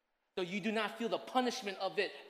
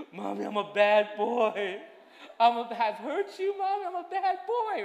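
put-on high, slow, louder tearful child's voice; crying little boy; 5 semitones higher, 9 dB louder than usual. Son sobbing to his mommy, a high tearful child. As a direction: wail it like a remorseful little boy. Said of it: "and then he's like".

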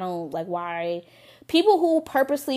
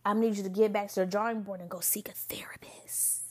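ASMR whisper; 5 dB quieter than usual. Shown as a demonstration. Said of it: "in my A-, A S M R voice".

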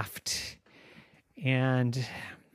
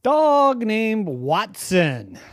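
drawn-out, louder naming; slow grand reveal; 6 semitones higher, 6 dB louder, much slower than usual. A narrator's reading. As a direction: land the name big and slow.

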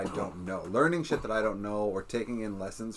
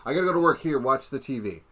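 busy parent; 4 semitones higher, 5 dB louder than usual. Retold or imitated. Parent voiced in a retold scene.

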